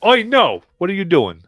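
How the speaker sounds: loud, high "Oi, no!" challenge; guard yelling at an intruder; guard shouting. Acted. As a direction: stop him with a sharp yell. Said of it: "and go".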